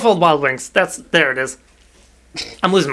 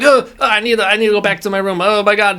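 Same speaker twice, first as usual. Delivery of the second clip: higher-pitched imitation of panic; retold panic, pitched up; mock freaking out; 5 semitones higher than usual. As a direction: play-act a flustered need to escape. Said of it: "starts freaking out".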